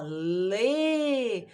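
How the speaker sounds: "lê" stretched long on its vowel; drawn-out syllable. Shown as a demonstration. Teacher asking a pupil what she hears, long sustained "lê". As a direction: hold "lê" out for the child to hear. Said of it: "Pra ficar mais audível pra ela".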